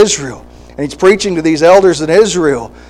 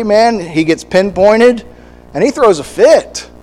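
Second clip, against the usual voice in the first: ordinary talk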